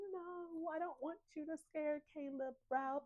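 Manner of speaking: high, soft pleading; quiet plea. Retold or imitated. Gemma pleading with Ralph to spare Caleb, soft. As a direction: beg him softly.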